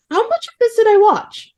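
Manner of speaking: high-pitched mock disbelief; astonished reaction. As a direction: squeak it like a stunned viewer. Astonished viewer, acted out.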